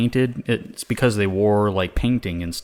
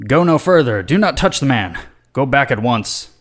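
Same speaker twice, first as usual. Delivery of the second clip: higher, mildly dramatic command; commanding order; 4 semitones higher, 3 dB louder than usual. Acted. Saint Columba ordering the creature back, mildly commanding. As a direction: issue a lightly acted stern order. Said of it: "orders the creature to".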